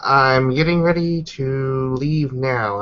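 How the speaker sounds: usual speaking voice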